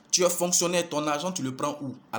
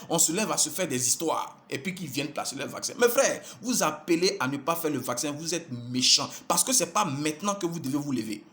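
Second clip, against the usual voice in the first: normal talking voice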